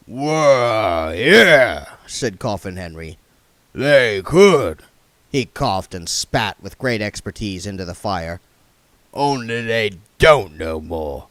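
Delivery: put-on hoarse, loud old tramp; rough coughing vagrant. A narrator's reading. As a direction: growl it like a sick tramp. Coffin Henry voice.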